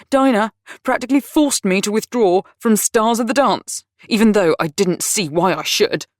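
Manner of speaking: rant, everything's falling apart; angry rant. A narrator's reading. Dudley ranting at Lizzie about lost jobs, angry and loud.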